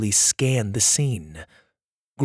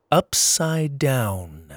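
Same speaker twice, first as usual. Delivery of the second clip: ordinary delivery, nothing marked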